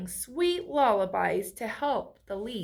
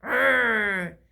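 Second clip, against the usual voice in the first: mock growl; 5 dB louder than usual. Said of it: "tried to make an angry face".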